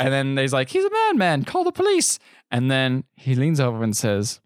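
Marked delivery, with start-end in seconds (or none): 0.7-2.2 high-pitched panicky imitation; mock alarm. Bankers reacting to a madman, mock-alarmed. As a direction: shoot the pitch up in comic alarm.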